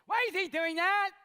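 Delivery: put-on high, loud child's voice; loud nosy kid; little girl.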